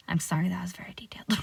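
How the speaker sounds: quick embarrassed whisper; whispered apology. Real, not acted. To viewers after a creepy description, a fast sheepish whisper.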